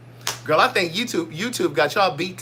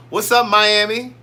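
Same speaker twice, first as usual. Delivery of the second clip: ordinary talk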